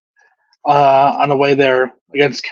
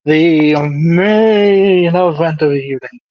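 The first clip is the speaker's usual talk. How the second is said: ring announcer. Pretend. Co-host introducing the main event, mock ring announcer. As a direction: draw out each word like introducing a main event.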